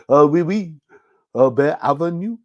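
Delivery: playful mock French accent; hammed-up French accent. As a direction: put on a jokey French accent. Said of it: "Do you do a French accent".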